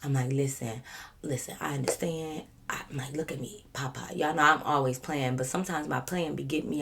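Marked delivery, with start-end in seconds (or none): 0.3-4.1 softer, lower-pitched plea; calm and collected. Retold or imitated. Reasoning with the grandfatherly officer, calm and collected. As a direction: keep it cool and low-key. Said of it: "I try to be the calm, cool, collective one".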